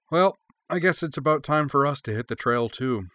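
a hint of a cheerful traveler; Cora's voice. A narrator's reading. Cora, character voice.

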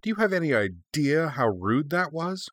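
high-pitched, affronted question; indignant snarl. A narrator's reading. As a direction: demand it in mild outrage. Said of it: "she snarled".

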